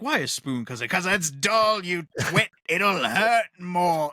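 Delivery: lightly voiced Rickman sneer, drawn out slow at the end; a hint of the Sheriff of Nottingham, slow and sneering; Alan Rickman impression. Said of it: "attempted to do it in his accent".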